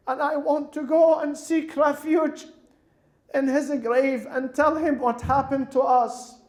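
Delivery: high, ringing preaching voice building through the tragedy; impassioned lament preaching. Acted. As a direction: preach the girl's story at full emotional force, pitched high. High-pitched tragic majlis narration.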